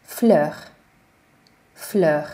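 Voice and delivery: Fleur as flø with rounded ö; French fleur. Shown as a demonstration. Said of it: "az Ö".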